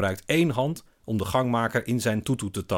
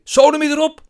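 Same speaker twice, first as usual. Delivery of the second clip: very loud, high-pitched yell; furious outburst; 14 dB louder than usual. A narrator's reading. Man dismisses narrator's suggestion, furious. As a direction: shout it at full blast. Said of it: "schuimt hij".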